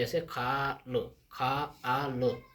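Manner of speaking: खा आ लो stretched very slowly, the आ held long; drawn-out syllables. Shown as a demonstration. Teacher sounding out खा आ लो slowly for pupils. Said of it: "उसकी ध्वनि आ रही है हमारे मुंह से".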